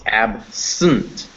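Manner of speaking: absent split in two slow parts; syllable by syllable. Shown as a demonstration. Teacher sounding out "absent" for students, syllable by syllable. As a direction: say "absent" as AB, then SUNT with syllabic N. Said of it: "let's go with syllable by syllable".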